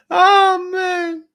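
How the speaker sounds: high, loud cracking-up exclamation; laughing. Real, not acted. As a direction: burst out helplessly with laughter. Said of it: "I shouldn't laugh".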